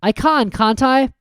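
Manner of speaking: hopeful request; Harry's voice. Acted.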